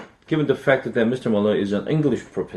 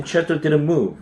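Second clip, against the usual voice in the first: usual speaking voice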